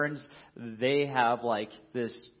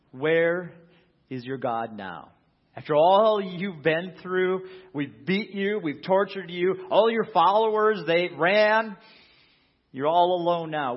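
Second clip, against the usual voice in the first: mocking taunt; 6 semitones higher, 7 dB louder than usual. Crowd taunting Jesus on cross.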